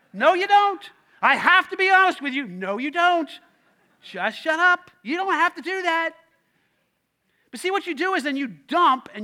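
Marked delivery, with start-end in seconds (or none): 0.1-0.9 higher, louder firm no; a loud flat refusal; emphatic retort. Shown as a demonstration. 1.2-2.5 higher, louder mock self-righteous honesty; loud imitation of an oversharer; mock dumper. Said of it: "You're like". 2.5-6.1 blunt retort. Acted. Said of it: "preacher retorting to dumper".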